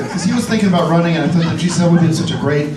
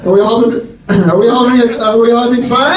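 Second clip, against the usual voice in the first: higher and louder, pumping up the room; rally the audience; crowd hype; 5 semitones higher, 6 dB louder than usual. Acted. Comedian hyping the crowd, loud and high. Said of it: "crowd hype".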